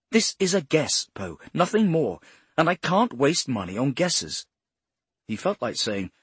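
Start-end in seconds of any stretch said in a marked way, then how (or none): 0.1-4.4 raised, higher angry voice. Acted. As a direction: push back on him hard. Boss rebukes Poe's guess.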